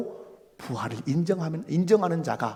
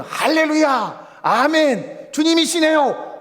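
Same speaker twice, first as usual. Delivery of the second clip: loud, high, drawn-out cheers; exultant shouts of praise; jubilant praise; 9 semitones higher, 5 dB louder, much slower than usual. Acted. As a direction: cry out in joy like overjoyed disciples. Imagined disciples rejoicing with praise.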